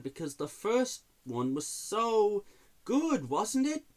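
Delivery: high, mock-gushing praise; sarcastic. Real, not acted. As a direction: oversell it so nobody believes you. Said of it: "I'm being sarcastic".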